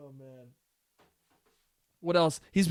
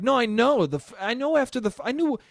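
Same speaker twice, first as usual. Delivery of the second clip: higher, impatient protest; mock exasperation; 7 semitones higher than usual. Son telling dad he already knows, exasperated. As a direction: cut in as if sick of hearing it.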